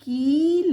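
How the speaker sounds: कील high and loud with a held ee; stretched long vowel. Shown as a demonstration. Teacher repeats the long i word, stretched vowel. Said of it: "थोड़ा बढ़ा करके बोलेंगे".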